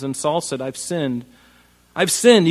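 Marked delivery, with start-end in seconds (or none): 1.9-2.5 louder, higher, hammed-up remorse; mock tearful confession. Saul confessing to David with mock crocodile tears. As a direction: fake a crocodile-tears cry of guilt. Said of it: "You can hear it in his voice".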